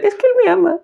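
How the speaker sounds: very high, mock-sweet woman defending her abuser; mocking battered woman. Mocking a woman who defends her abuser, in a very high put-on voice. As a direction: squeak it out like a woman excusing the man who hits her. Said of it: "el güey que les pega, y lo quieren defender".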